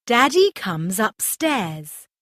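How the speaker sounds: plain reading voice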